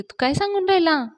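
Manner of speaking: high-pitched happy disbelief; delighted surprise. A narrator's reading. Girl thrilled at puja news, high-pitched delighted surprise. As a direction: lift the pitch in pleased surprise.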